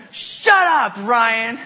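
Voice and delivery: high, loud retort; mock furious little sister. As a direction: imitate an exasperated kid yelling at a brother. Sisters' furious response, acted.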